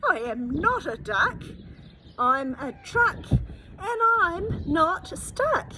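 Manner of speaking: loud, rising to high and slow at the end; old truck shouting to the river in triumph; triumphant shout. A narrator's reading. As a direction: crow it out, slowing on the last line. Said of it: "he shouts to the river".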